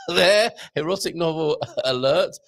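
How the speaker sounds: squeaky high laugh, then laughing through the words. Real, not acted. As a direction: giggle out the alert. Laughing at a viewer's comment.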